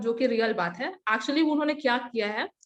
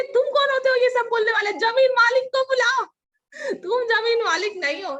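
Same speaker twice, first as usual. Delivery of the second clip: much higher and louder, a strained outburst; imitated furious yell; 10 semitones higher, 6 dB louder than usual. Uncle shouting at the neighbour girl, furious yell. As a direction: scream it as the enraged uncle.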